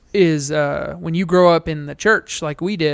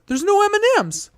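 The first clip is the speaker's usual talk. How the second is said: put-on much higher, whiny-kid letdown; mock disappointed kid; 12 semitones higher than usual. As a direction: imitate a kid's dismayed complaint. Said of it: "Like, he was disappointed".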